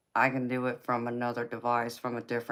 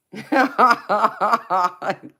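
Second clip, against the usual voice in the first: much louder, high burst of laughter; 6 semitones higher, 10 dB louder than usual. Real, not acted. Streamer laughing at Joseph's comment, loud and high.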